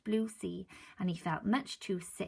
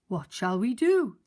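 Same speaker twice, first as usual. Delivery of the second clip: louder worried question; worried old man; 8 dB louder than usual. Acted. Mister Grinling asking his wife, louder worried. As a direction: ask it anxiously.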